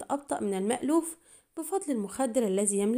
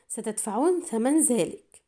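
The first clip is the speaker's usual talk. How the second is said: plain reading voice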